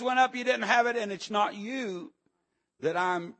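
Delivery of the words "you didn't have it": ordinary delivery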